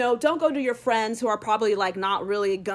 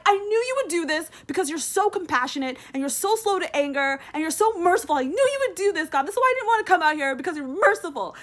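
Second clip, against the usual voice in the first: high, lightly hammed-up sulky complaint; a hint of a pouting prophet griping; whiny Jonah; 8 semitones higher, 4 dB louder than usual. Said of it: "And then Jonah was just like".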